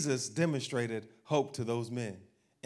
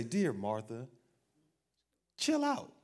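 calming Jesus; 4 semitones lower, 5 dB quieter, slower than usual. Retold or imitated. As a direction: settle her down gently. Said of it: "what did you say?".

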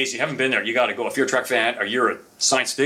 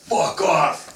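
brusque dismissal; 6 semitones higher than usual. Retold or imitated. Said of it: "and he goes".

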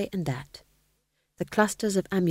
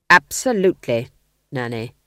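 ghost king insisting; 5 semitones lower, 6 dB louder than usual. A narrator's reading. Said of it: "said the ghost".

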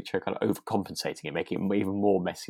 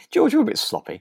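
high, teasing mock verdict; pitched way up, playful jab at George; mock remark to a baker; 4 dB louder than usual. Podcast host teases a sloppy baker, high and playful. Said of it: "I was gonna say".

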